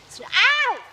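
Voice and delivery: high, loud yelp of pain; mimicked dog yelp. Shown as a demonstration. Trainer mimicking what dogs do, high loud yelp. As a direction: yelp like a hurt pup. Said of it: "mimicking what dogs would do".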